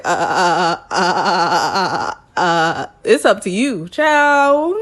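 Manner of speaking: mock-panicked stuttering "uh, uh"; flustered stammer. Zach dodging the kids question, hammed-up flustered stammer. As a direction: stammer like a cornered groom. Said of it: "The look on his face was like".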